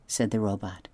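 usual speaking voice

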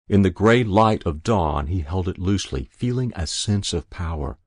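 ordinary delivery

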